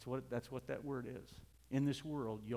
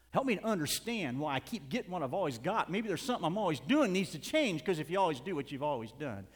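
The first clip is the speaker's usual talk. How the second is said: raised-voice sermon delivery; emphatic preaching; 4 semitones higher, 8 dB louder than usual. Real, not acted. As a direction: press the point on the congregation. Preaches at full force.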